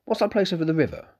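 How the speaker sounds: put-on eager young innkeeper's son; young Malcolm.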